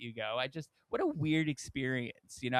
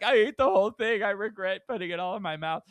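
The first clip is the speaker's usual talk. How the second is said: higher, louder groaning regret as Ryan; playing up Ryan's stuffed regret; mock Ryan regret; 6 semitones higher, 6 dB louder than usual. Friend retelling Ryan's pickle regret, mock groan. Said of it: "he's like".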